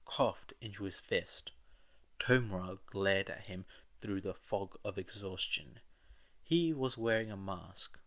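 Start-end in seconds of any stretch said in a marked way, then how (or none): none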